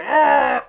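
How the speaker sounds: high, loud hammed-up "Ah!" of pain; orc pained yell. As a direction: cry out as a struck orc. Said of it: "and they both go".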